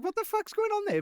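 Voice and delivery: mock bewilderment at a weird text; baffled. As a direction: friend reacting to a nonsense reply. Reacting to baffling text reply.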